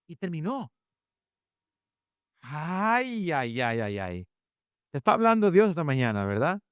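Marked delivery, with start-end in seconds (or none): 2.4-4.3 drawn-out, sinking "ay, ay, ay"; teasing groan that slides downward; mock lament. Preacher teases guilty parents in congregation, mock lament.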